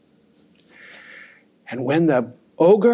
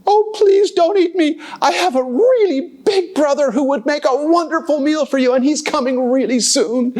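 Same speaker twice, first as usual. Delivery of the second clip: high, pleading goat voice; 11 semitones higher than usual. Acted. Middle goat begging the troll, high and pleading. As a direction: beg for your life, high.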